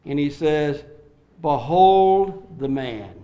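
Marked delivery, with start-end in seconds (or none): 1.4-3.2 Behold the man, drawn out slowly and loud; slow solemn proclamation. Acted. Pilate showing Jesus to the crowd, slow and solemn. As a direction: let each word of Behold the man hang.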